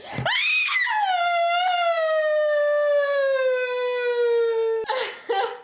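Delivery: long, high, piercing scream of fright; real fear scream. Real, not acted. Streamer startled by a moving worm screams in terror. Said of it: "c'est le vrai cri de la peur".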